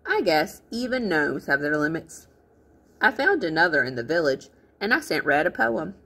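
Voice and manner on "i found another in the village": plain reading voice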